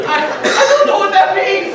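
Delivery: loud laughter spilling into the words; laughing. Real, not acted. Telling the congregation about mom's text, laughing hard. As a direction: laugh through the confusion. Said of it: "laughing at own confusion".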